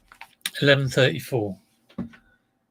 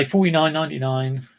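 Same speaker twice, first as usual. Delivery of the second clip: ordinary talk, nothing marked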